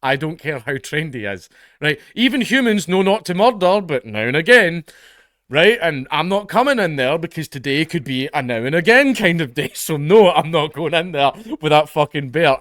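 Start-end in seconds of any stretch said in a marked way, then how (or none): 2.1-9.7 louder, heated rant; emphatic rant. Real, not acted. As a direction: drive the point home louder. Ranting about the bear.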